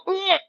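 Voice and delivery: sharp, high grunt as if bracing for a hit; effort grunt. Shown as a demonstration. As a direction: brace and grunt. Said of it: "Flex your glutes like somebody's about to smack you. Go".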